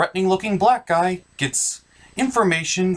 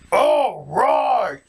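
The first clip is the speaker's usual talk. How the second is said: villain shout; 5 semitones higher than usual. Acted. Villain orders the killing.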